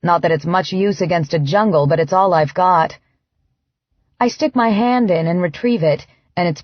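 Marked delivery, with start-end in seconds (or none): none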